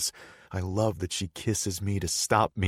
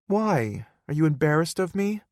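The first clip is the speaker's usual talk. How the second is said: put-on higher pitched playful girlfriend; young woman voice; 11 semitones higher, 5 dB louder than usual. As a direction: voice her higher, coaxing.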